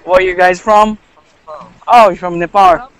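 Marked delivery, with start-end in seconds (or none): none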